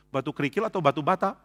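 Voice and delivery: ordinary talk, nothing marked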